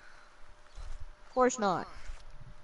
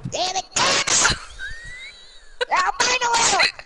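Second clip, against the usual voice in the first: angry; 8 semitones higher than usual. Real, not acted. Raging at teammate's shooting.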